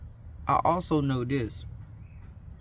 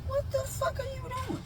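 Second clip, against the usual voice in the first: hushed high whisper; 8 dB quieter than usual. Retold or imitated. Asking friend hiding under table.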